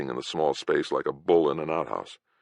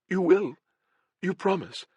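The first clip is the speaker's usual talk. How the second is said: high, eager little voice; hopeful girl. Acted. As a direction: ask with fragile hope. Dying girl hoping.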